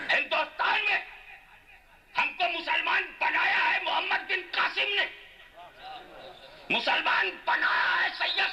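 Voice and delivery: high, insistent declamation; high-pitched, repetitive rallying litany; full-force preaching. Real, not acted. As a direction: hammer out a rhythmic roll of honour. Preacher's chanted roll of names.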